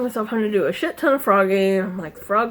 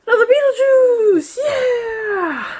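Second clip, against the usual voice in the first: high, loud cheering; excited cheer; 13 semitones higher, 6 dB louder, slower than usual. Real, not acted. Showing viewers another Beetlejuice piece, high excited cheer. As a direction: celebrate the next finished piece.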